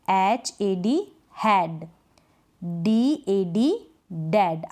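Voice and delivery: slow, letter by letter spelling; slow spelling drill. Shown as a demonstration. Teacher drills spellings for students, slowly. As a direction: spell each word out slowly. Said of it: "spelling drill, slow".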